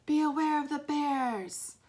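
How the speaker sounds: slow, taunting shout; mocking shout. A narrator's reading. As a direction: call it out slowly to spook them. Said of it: "shouted Henry".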